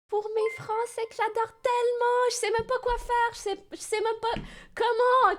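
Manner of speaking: high-pitched, whiny pleading; overwrought apology. Real, not acted. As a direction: plead helplessly in a high whine. Overwrought apology to French viewers.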